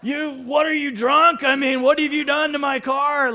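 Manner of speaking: high, loud furious demand; intense angry yell. Retold or imitated. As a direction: confront the driver over the car. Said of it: "I'm yelling at, I'm not yelling actually, but I'm intense".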